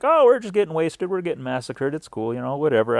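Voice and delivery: high, loud, breezy shrug of a reply; mock nonchalant. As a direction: laugh off the massacre to the wife. On phone amid massacre.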